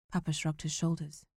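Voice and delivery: ordinary delivery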